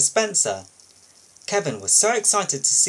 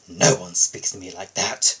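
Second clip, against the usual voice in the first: offended pride; 7 semitones lower, 3 dB louder than usual. Acted. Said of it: "he thought to himself".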